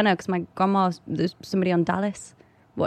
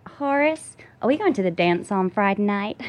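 Southern accent. Shown as a demonstration. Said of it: "how's your Southern accent".

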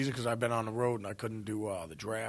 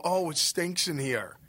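whiny complaint; 6 semitones higher, 4 dB louder, slower than usual. Retold or imitated. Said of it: "don't sit here and go".

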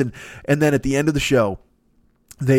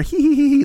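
high, loud mock-demure tittering; cutesy giggle; 13 semitones higher, 4 dB louder than usual. Mocking a coy guest with a high titter.

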